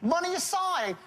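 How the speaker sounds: very high, pushed-away phrase; money-aside tone. Shown as a demonstration. Sales trainer voices the hidden message to prospect, high and dismissive. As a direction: wave it aside with a high lilt. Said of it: "I push the money aside with my tone of voice".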